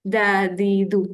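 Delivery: gentle Arabic dal on each held syllable; softened d. Shown as a demonstration. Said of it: "Jadi kita lembutkan dia".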